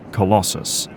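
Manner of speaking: normal talking voice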